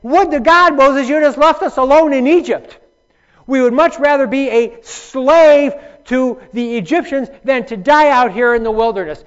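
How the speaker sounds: high, loud, whining grumble of frightened people; loud, high-pitched bellyaching Israelites; whiny complaining crowd. Retold or imitated. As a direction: moan it like scared complainers begging to go back. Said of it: "the people are bellyaching and they're crying".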